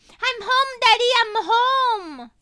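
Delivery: put-on high, loud happy toddler voice; a small child chirping with joy; chirping little girl. A narrator's reading. Little girl hugging her father, chirping happily. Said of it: "she keeps on chirping".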